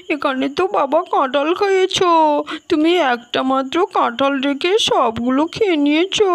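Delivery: ordinary talk, nothing marked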